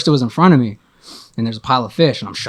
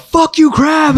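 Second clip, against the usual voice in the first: yelled much higher and louder; 13 semitones higher, 4 dB louder, much slower than usual. Retold or imitated. Snapping at the crab in the fish room, full yell. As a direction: let it rip at the crab. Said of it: "I just yelled".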